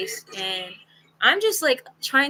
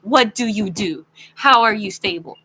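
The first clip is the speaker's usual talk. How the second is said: louder, emphatic retold questioning; insistent demand; 7 dB louder than usual. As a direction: press hard for an answer. Retold pressing for his job.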